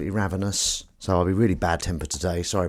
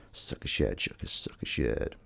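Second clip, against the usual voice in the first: soft unvoiced whisper of sucker shared; 11 semitones lower, 10 dB quieter than usual. Shown as a demonstration. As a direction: breathe sucker shared out softly. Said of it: "first said plainly".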